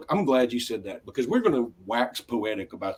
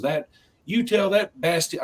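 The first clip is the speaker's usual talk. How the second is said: higher friendly Southern ribbing; imitating Houston guys; 8 semitones higher than usual. Quoting the Seinfeld Houston guys, mock Southern ribbing. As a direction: ham up the good-old-boy buddies. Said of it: "all they kept saying was".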